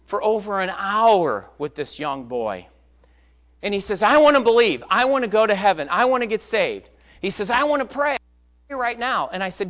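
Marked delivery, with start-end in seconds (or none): 4.0-6.9 loud, higher, pleading repeats; insistent. Retold or imitated. Boy begging preacher to be saved, insistent. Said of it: "He was so insistent". 7.5-9.3 higher, louder urgent demand; insistent. Retold or imitated. Boy demanding to pray at once, insistent. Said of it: "He was so insistent".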